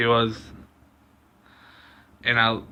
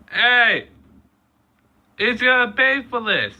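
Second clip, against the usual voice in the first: very high, loud shout after her; 12 semitones higher, 4 dB louder than usual. Retold or imitated. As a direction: call out loudly as she drives off. Yelling after the fleeing driver.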